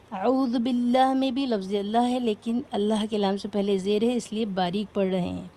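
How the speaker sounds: ordinary talk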